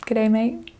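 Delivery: soft Aussie "Good day, mate" rising on mate; greeting like a local in Australia; Australian accent. Retold or imitated. Australian accent greeting a new friend with a light lift. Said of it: "they really do say".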